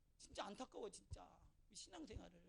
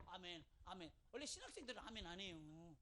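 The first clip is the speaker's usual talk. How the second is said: usual speaking voice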